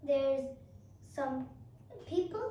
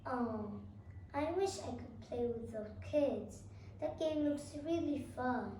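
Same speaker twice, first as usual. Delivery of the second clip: soft, lower longing murmur; wistful to self; 3 semitones lower, 6 dB quieter than usual. Shown as a demonstration. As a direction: sigh it out timidly. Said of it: "They would be like".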